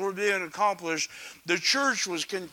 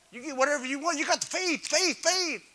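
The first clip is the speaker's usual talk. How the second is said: higher mock televangelist sell; mocking prosperity preacher; 8 semitones higher, faster than usual. Preacher mocking prosperity preachers to congregation. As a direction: ham up the faith pitch. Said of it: "prosperity preachers tell you".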